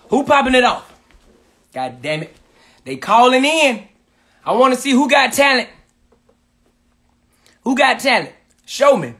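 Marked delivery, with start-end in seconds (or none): none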